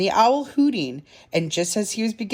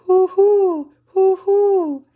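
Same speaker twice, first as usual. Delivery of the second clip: high, loud hoo-hoo call; owl hoot; 12 semitones higher, 6 dB louder, much slower than usual. A narrator's reading. Someone calling in the night, high owl hoots. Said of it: "and someone calling".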